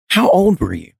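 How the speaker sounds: ordinary talk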